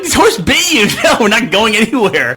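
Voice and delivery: high, loud disbelief; can't believe she wants to leave; incredulous. Retold or imitated. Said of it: "I'm like".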